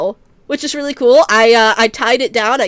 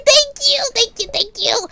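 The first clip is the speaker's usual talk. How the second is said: gushing thanks; 10 semitones higher than usual. Real, not acted.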